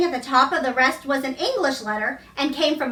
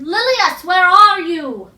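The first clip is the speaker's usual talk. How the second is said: higher, louder, slow call; 4 semitones higher, 6 dB louder, slower than usual. A narrator's reading. Archie calling back to his dead wife, searching and loud. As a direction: reach out across the garden with your voice.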